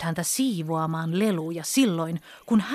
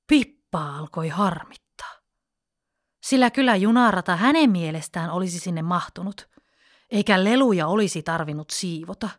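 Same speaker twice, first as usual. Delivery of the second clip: usual speaking voice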